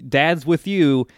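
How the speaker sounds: teasing surprise act; mock oops. Mock reveal of Dad taking over.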